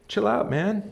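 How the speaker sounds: lightly voiced easygoing Solomon, tossing it off; laid-back Solomon quote. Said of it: "Says Solomon".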